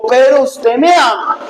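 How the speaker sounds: mildly insistent acted challenge; insistent questioning. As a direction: act out rubbing the question in. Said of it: "Ya en el tercero le dijo".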